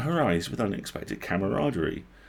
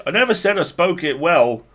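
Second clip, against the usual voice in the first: defensive protest; 10 semitones higher, 9 dB louder than usual. Acted. Murray defensive.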